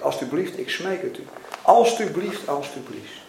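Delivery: ordinary delivery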